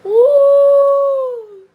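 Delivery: a very high, loud whoop; gleeful whoop. Real, not acted. Mother cracking up at her daughter's answer, loud whoop. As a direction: throw your head back and whoop.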